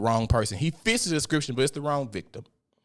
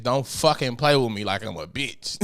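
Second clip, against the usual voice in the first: normal talking voice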